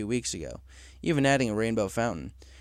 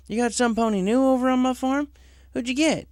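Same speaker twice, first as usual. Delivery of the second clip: higher, lively farm-pony voice; Applejack voice; 12 semitones higher than usual. Acted. Applejack tells Cloud Chaser her orchards were already clear. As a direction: tell it with amazement.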